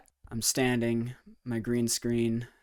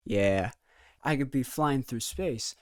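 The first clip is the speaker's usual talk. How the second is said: ordinary talk, nothing marked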